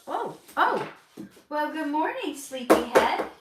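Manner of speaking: high, sing-song wake-up hello; sweet morning greeting. Real, not acted. Greeting toddler who woke up.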